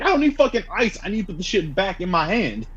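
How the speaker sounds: high-pitched retold exasperation; exasperated protest. Injured student refusing the offered ice, exasperated. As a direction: protest in disbelief, voice jumping way up.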